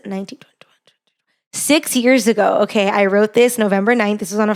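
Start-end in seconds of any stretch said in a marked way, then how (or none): 0.1-0.8 whispered counting to oneself; counting under breath. Real, not acted. Vlogger working out how many years ago, whispering. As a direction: whisper it, barely audible, as you work something out.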